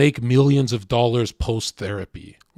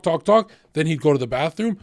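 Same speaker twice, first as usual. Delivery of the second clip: ordinary talk, nothing marked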